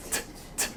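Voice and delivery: "t" as a tiny voiceless puff, no uh after; short crisp t. Shown as a demonstration. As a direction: clip the "t" with no vowel. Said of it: "Very short, no uh sound after".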